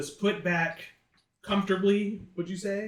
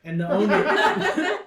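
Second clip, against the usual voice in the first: cracking up mid-phrase, higher and louder; laughing through words; 4 semitones higher, 8 dB louder, slower than usual. Real, not acted. Game master losing it while recounting the bartender's crime, laughing. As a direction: break into real laughter while dragging out the words. Breaks up laughing, apologizes, restarts.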